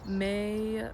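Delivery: ordinary delivery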